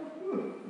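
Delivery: sudden high laugh; squeaky laugh; high burst of laughter. Real, not acted. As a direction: laugh out at the memory. Laughs at own story.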